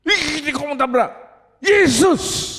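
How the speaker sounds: high-pitched alarm rising to a yell; acted panic before a crash; panicked outcry. Driver about to be hit calls on Jesus, panicked.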